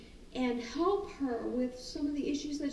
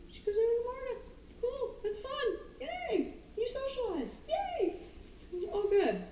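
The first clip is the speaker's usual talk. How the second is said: high happy praise, yay; praising the dog; 11 semitones higher than usual. Acted. Trainer praising dog for finding Marta, high happy. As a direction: cheer the dog on, much higher.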